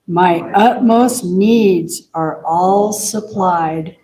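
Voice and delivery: measured, drawn-out group recitation; very slow reading. Real, not acted. Leader reading hymn verses with congregation, very slowly. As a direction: give each word room to be absorbed. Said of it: "let's read it very slowly".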